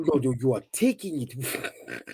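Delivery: slow, bad African accent. Shown as a demonstration. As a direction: do a terrible African accent slowly. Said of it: "I have a terrible African accent".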